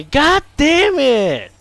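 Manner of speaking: fuerte y estirado de rabia; grito frustrado lento. Real, not acted. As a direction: alarga la maldición con furia. Jugador frustrado grita. (el jugador grita por el bug)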